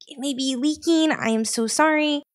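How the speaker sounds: high-pitched, sheepish confession; acted-out awkward admission; embarrassed apology.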